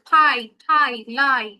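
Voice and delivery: ফাই, ঠাই, লাই, each loud with a clear eye vowel; long I sound. Shown as a demonstration. Teacher modelling ie words, ফাই, ঠাই, লাই with a long I. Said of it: "আই এর মতো হবে".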